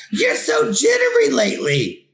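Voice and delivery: lightly voiced mom chiding her son; fussing mom. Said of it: "his mom asked".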